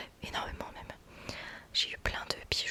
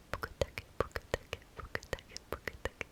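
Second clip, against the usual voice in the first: ASMR trigger word; 5 dB quieter, slower than usual. Acted. Repeats trigger word softly.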